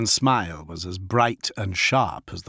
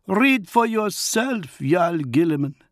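Fafnir the wolf lord; 10 semitones higher, 3 dB louder than usual. Acted. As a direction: speak as the feral wolf lord, measured. Fafnir offers parchment.

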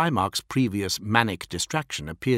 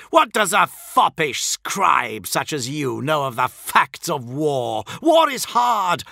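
loud, higher, scornful tirade; furious snarl; 6 semitones higher, 4 dB louder than usual. A narrator's reading. Fulgrim berates a foppish scribe, snarling. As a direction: tear into him with contempt. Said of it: "snarled Fulgrim".

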